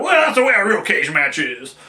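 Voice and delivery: lightly voiced smug purist; a hint of a know-it-all fan; mock purist. Mocking hardcore purists, lightly voiced smug fan. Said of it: "hardcore N W A purists".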